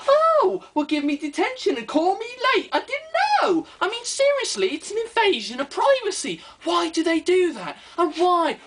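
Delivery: mock-offended customer, pitched well up; prissy customer. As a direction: play an offended fussy customer, voice way up.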